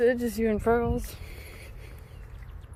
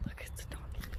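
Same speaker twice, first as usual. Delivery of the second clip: soft whisper; 15 dB quieter, faster than usual. Real, not acted. Pointing out the donkey in a whisper. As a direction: breathe it to yourself.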